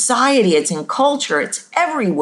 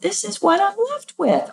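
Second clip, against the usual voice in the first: high, quick put-on voice; higher pitched sound; 13 semitones higher than usual. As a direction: squeeze it up high, rushing a bit. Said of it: "doesn't have to be a higher pitched sound".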